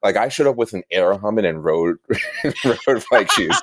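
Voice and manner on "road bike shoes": breaking up laughing, pitched up. Real, not acted. Guest admitting to wrong bike gear, laughing. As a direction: say it through helpless laughter.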